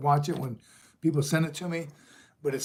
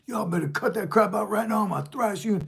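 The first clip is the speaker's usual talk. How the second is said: gruff enlisted Marine; 7 semitones higher than usual. Retold or imitated. Senior enlisted telling Marines to stop, mock yell. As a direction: bark it like a senior NCO. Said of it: "Marines are gonna come and say".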